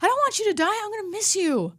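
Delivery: high and loud, angry but loving; demonstrating angry grief. Shown as a demonstration. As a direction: let the anger out as a sample line. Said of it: "you're angry, you go".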